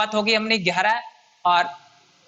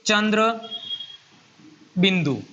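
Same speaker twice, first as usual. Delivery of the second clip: "चंद्र" and "बिंदु" said apart with a pause between, falling at the end; spaced naming. Shown as a demonstration. Teacher naming the mark for students, each word stressed. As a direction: lay out "चंद्र ... बिंदु" word by word, ending on a fall. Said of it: "ये है".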